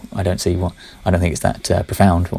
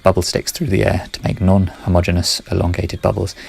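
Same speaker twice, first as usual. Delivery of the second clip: ordinary talk, nothing marked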